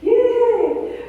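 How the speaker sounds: high excited yay; excited cheer. Real, not acted. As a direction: cheer them on brightly. Cheering for the visitors.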